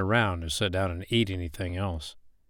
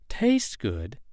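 "Tastes good?" rising in disbelief, slightly higher; incredulous echo; 3 semitones higher than usual. Acted. Woman echoing the man's claim about the snake, disbelieving. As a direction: throw his words back at him doubtfully. She repeats his words in disbelief.

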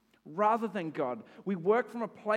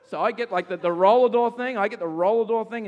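panicked rush; 5 semitones higher, 11 dB louder than usual. Retold or imitated. Panicking to shut door.

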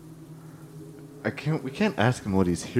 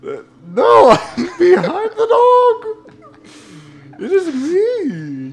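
high silly voice; 6 dB louder, slower than usual. Acted. NPC voice.

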